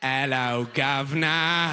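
stretched-out cartoon Cockney; mock British accent. Delighted listener mimics a British person, mock British accent. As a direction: parrot British sounds, drawing out the vowels. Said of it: "They're just making sounds you like".

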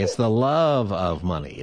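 slow correction, weight on "love"; stress on LOVE. Real, not acted. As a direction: lean long and hard on "love".

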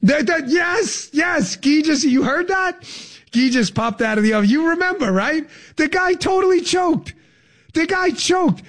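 high, gleeful, near-shouting; crowing with delight at the memory; excited. Real, not acted. Host whooping at producer Guy over a shared memory, gleeful.

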